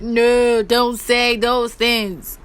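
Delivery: very drawn-out mimicry; slow, hammed-up mock objection; mock reproach. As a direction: stretch each word in exaggerated imitation. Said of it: "And she's like".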